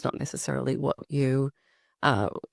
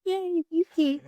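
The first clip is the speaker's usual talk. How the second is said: excited yay; 13 semitones higher, slower than usual. Real, not acted. Guest greeted warmly on arrival.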